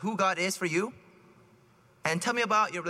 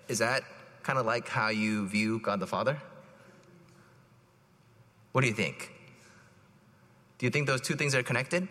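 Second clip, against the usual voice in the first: gentle counseling; 6 semitones lower than usual. Retold or imitated. Priest gently asking the adopted man about God, calm.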